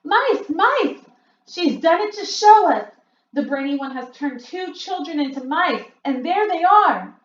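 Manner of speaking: high, loud startled witch cries; witches crying out. A narrator's reading. Witches point at the platform, high and loud. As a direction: leap up pointing and cry out. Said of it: "crying out".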